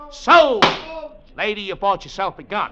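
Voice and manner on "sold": loud, final "Sold!" call; auctioneer's shout. Acted. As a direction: bang it out like a gavel. Auctioneer closing the sale.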